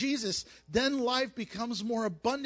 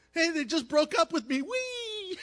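bright whoop, pitch flying up on whee; carefree joyride whoop; 8 semitones higher, 3 dB louder than usual. Acted. Preacher acting a jet ski rider shrugging off a breakup, gleeful whoop. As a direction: toss off the breakup and squeal with joy.

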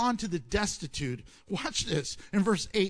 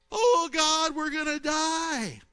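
loud high cry, then slowing on die; panicked cry; 7 semitones higher, 3 dB louder, slower than usual. Acted. Disciples in the sinking boat crying out, panicked.

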